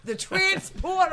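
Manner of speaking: high, slow, delighted call; excited exclaim. Real, not acted. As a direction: sing out the title, thrilled. Reacting to a chat suggestion.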